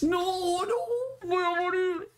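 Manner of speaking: very high anguished wail; anguished cry. Real, not acted. Gamer despairs as the shootout slips away, anguished high wail. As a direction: wail as if it's over. Penalty goes wrong.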